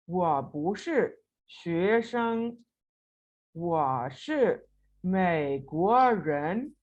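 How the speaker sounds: very slow, each syllable spelled out; deliberate drill reading; slow model reading. Shown as a demonstration. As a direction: read it word by word for learners. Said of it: "कैसे complete होगा जी?".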